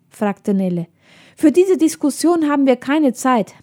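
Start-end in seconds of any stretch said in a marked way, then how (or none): 1.4-3.5 higher, impatient rush of alarm; breathless urgency. A narrator's reading. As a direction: cut the debate short, urgently. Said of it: "meinte das Mädchen atemlos".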